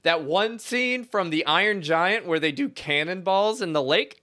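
high, eager retold exclamation; giddy, laughing by the end; excited wish. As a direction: act out a gleeful idea, starting high and loud. Acting out his excited wish in dream.

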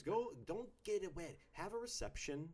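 high, loud, emphatic declaration; triumphant proclamation. Real, not acted. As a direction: proclaim it loudly, like a hot take. Triumphantly proclaiming an opinion over cheering.

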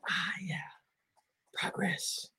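whispered, high little cheer. Real, not acted. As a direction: whisper it to yourself, pleased. Quiet self-congratulation at camera.

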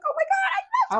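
very high mock squeal; imitating gushing. Imitating partner over Squishmallows, mock gushing. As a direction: gush in a put-on high voice. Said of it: "this bitch will always be like".

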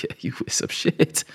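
voiceless mock whisper from dad; imitating dad's silent grin; whispered father voice. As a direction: breathe it like a smug dad. Father's grinning look voiced.

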